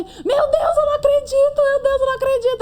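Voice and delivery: ecstatic high-pitched shouting; disbelieving scream. Retold or imitated. Seeing her name on the list, screaming in disbelief. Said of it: "Aí eu comecei a gritar".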